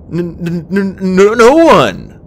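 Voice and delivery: frightened, halting stammer; nervous stammer. A narrator's reading. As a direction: stumble fearfully on the first sound. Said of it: "Starflight stammered".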